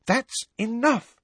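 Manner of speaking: high, slow, firm and cross; crossly. A narrator's reading. Mother stopping the questions about the snake, cross. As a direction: end the talk, cross and firm. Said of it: "said Missus Weasley crossly".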